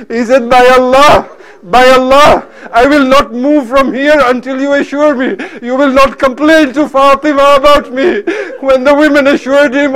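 high-intensity, anguished martyrdom narration; fervent majlis lament; impassioned preaching. Acted. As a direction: preach it at full force, grieving.

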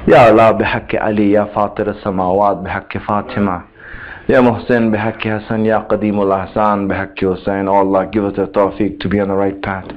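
low, soft, fast-murmured supplication; closing prayer. Acted. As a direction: rush through the dua in a low hush.

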